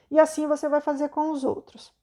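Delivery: ordinary delivery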